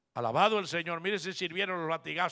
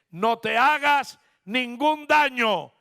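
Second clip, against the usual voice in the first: Paul's urgent cry, drawn out; loud, high, slow shout to stop him; shouting a warning; 7 semitones higher, 11 dB louder, slower than usual. Acted. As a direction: call it out across the prison. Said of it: "Pablo le grita y le dice".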